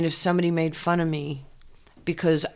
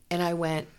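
ordinary talk, nothing marked

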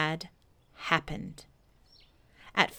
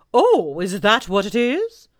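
put-on loud, slow, hooting old owl; 3 semitones higher, 9 dB louder than usual. Owl greeting Pooh, loud slow hooting owl voice. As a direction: boom it out like a pompous owl.

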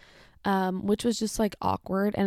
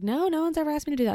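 higher, faster, a little louder; flustered high reply; 8 semitones higher, 4 dB louder, much faster than usual. Retold or imitated. Cashier flustered by the hat request, fast and high.

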